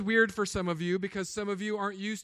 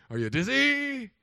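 high and louder playful mock concern; teasing question; 6 semitones higher, 5 dB louder than usual. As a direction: poke fun at the drunk, voice up high. Pastor teasing the drunk.

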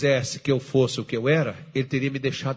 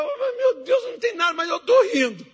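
much higher, giggly wife voice through laughter; imitating wife laughing. Wife giggling at her husband laughing in his room, high squeal.

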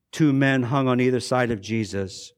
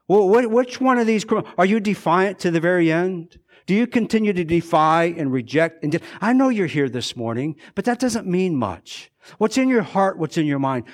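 a louder, higher preacher's appeal; fervent preaching; 6 semitones higher, 4 dB louder than usual. Acted. Raised preaching challenge to congregation.

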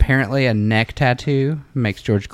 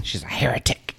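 whisper; 5 dB quieter than usual. Acted. Joking whisper aside.

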